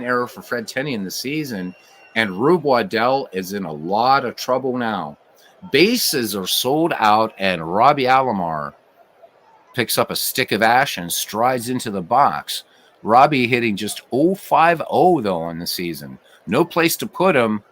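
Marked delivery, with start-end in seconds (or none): none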